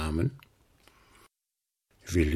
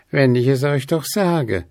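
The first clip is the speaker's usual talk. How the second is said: higher owl voice, stubborn; insistent owl; 6 semitones higher, 3 dB louder than usual. A narrator's reading. Willi insists to the ghosts, stubborn. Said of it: "zeigt sich der Kauz beharrlich".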